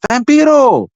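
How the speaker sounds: high, loud shout of a name; reenacted yell to get his attention; calling out. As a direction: holler it to someone far off. Calling a man for his dad.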